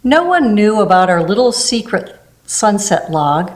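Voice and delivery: ordinary delivery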